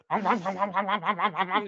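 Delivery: rhythmic growling hums like a cartoon bulldog; Spike growling. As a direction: growl it like Spike from Tom and Jerry. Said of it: "He's like".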